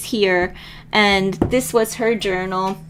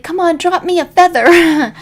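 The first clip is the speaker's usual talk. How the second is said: high, loud plea to the sky; loud hopeful call upward; calling out to the eagle; 9 semitones higher, 6 dB louder than usual. Retold or imitated. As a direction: shout up to the eagle, begging. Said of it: "I was like".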